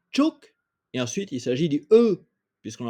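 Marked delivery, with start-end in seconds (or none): 0.1-0.5 Tchouk high, short, sharp tone; Vietnamese chúc. Shown as a demonstration. Teacher drills Vietnamese syllable for class, high sharp tone. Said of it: "puisqu'on entend le OU".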